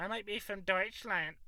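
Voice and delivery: high, loud hammed-up German accent; lightly put-on German accent on Deutschland; mock German accent. Mock German accent, joking about his ancestry. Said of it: "Or German".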